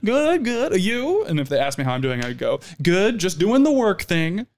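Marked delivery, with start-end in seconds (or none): 0.0-1.2 high-pitched diners answering the server; higher mock small talk; imitated polite customers. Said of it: "they're always like". 2.8-4.5 higher, slow put-on server voice; slow, higher customer-service patter; vanilla server joke. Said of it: "I'm so vanilla, like, as a server".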